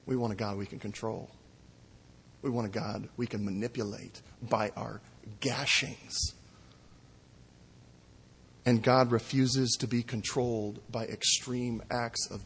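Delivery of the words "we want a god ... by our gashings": hushed. Real, not acted. Preacher drops voice to hush.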